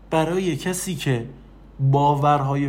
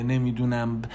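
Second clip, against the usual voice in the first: ordinary talk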